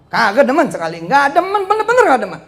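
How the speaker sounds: building to a high, loud insistence; emphatic dislike. Real, not acted. Hammers home his dislike, building. (makin tinggi dan keras, menegaskan tidak suka)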